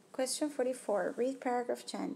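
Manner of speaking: usual speaking voice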